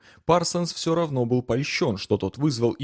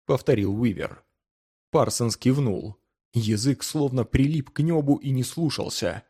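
ordinary delivery

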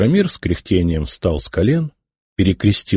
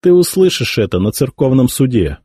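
smirking; 6 semitones higher than usual. A narrator's reading. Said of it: "усмехнулся Святополк".

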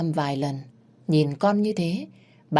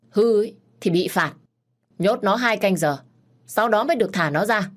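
louder, curt scolding; stern order; 5 semitones higher, 4 dB louder than usual. A narrator's reading. Master ordering the boy locked up as punishment. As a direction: lay down the punishment coldly.

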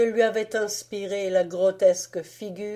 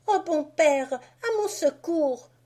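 put-on high girl's plea to her father; Marie calling for help; 9 semitones higher than usual. Marie to her father, high pleading cry. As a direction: call out to papa for rescue. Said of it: "dit Marie".

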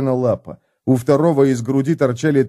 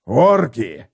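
growling. Acted. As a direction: growl it like a waking beast. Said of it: "прорычал Готрек".

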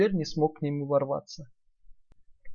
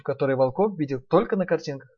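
usual speaking voice

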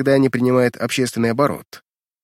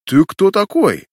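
demanding; 7 semitones higher, 5 dB louder, slower than usual. Acted. Guards and merchant demand together.

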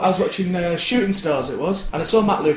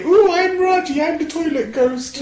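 ghost voice; 12 semitones higher, slower than usual. Pretend. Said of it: "do your ghost impression now".

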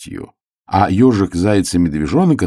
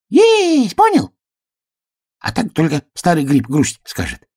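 very high, thin little voice; 11 semitones higher than usual. Retold or imitated. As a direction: squeak like a tiny mushroom. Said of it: "А потом тоненько".

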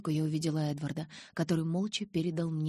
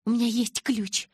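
mutter; 7 semitones higher, 6 dB louder than usual. A narrator's reading. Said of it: "пробормотала я".

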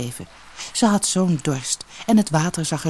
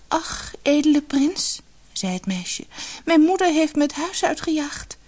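tearful; 11 semitones higher than usual. Acted. Weeping girl to the prince, high and plaintive. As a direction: lament to the prince, a touch of tears. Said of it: "zitten huilen".

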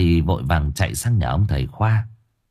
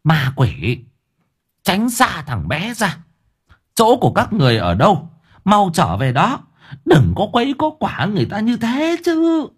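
put-on higher, commanding old woman driving spirits away; old woman banishing ghosts from the boy, scolding; 11 semitones higher than usual. A narrator's reading. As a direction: chase the ghosts off like a fierce grandmother. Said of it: "bà quát".

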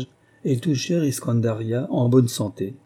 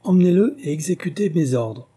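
higher, louder final command; shouted order; 6 semitones higher, 4 dB louder than usual. A narrator's reading. King shouting his final order to the guards, loud command. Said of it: "Et il cria encore une fois à ses gardes".

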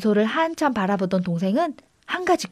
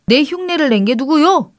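higher, mildly raised shout; indignant call, moderately raised; 5 semitones higher, 3 dB louder than usual. A narrator's reading. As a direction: call out to demand who is there. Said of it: "동생이 소리쳤습니다".

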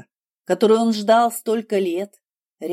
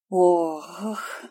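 gasping exhale. A narrator's reading. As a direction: let out a startled gasp. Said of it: "выдохнула девушка".

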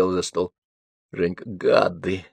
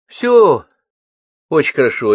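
indignant; 5 semitones higher, 5 dB louder than usual. Acted. Надюша обижена кадром.

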